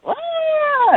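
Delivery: high-pitched drawn-out mock squeal; thin high wail, put on; imitated scream. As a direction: squeal like an upset kid. Mimics boy's outburst.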